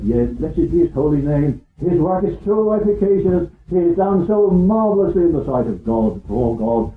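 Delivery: ordinary delivery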